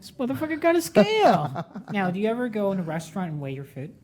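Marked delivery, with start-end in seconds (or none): 0.0-1.7 high-pitched imitation of a stunned man; mock astonishment. Mimicking the man seeing the food scale, astonished. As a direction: blurt it out in mimicked disbelief. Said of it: "his face when I pull out the scale".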